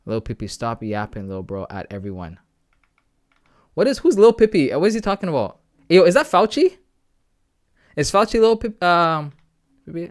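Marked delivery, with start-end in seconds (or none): 3.7-10.1 high, loud disbelief; who on earth is that, loudly; higher and louder, puzzled outburst; baffled. Real, not acted. Baffled by Discord chat.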